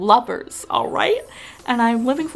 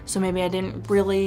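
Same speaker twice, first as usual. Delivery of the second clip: usual speaking voice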